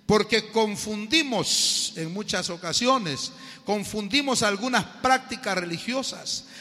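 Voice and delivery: loud, slow, high preacher's cry; a pastor thundering from the pulpit; fiery preaching. Acted. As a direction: rebuke the congregation at full force. Preaching at full force.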